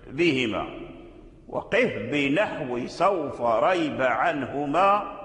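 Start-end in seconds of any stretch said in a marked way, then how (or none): 1.5-5.2 ترتيل النظم؛ إنشاد بطيء ممدود للبيت؛ رتّل البيت ترتيلًا هادئًا؛ إنشاد بيت الشاطبية بتمهل. Acted.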